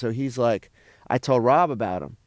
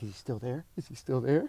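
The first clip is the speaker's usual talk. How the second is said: nervous hushed peeking; 2 semitones lower, 11 dB quieter than usual. Retold or imitated. Peeking out scared from lobby.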